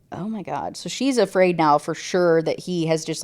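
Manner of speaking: usual speaking voice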